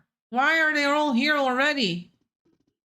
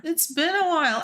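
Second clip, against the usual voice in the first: ordinary delivery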